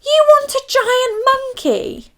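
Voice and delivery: high, loud outraged disbelief; raging Duke. Acted. Duke raging at the Queen's request. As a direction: spit out the question in a royal fury. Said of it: "the Duke raged".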